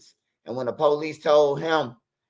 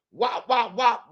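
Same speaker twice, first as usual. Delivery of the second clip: punch sound effects; 6 semitones higher, slower than usual. Retold or imitated. Imitating punches landing.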